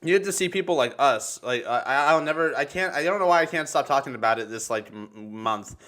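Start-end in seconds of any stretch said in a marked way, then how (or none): none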